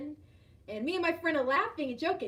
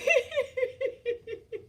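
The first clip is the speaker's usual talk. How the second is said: high giggling run; real, high laughter; amused laugh; 9 semitones higher than usual. Real, not acted. Laughs after story.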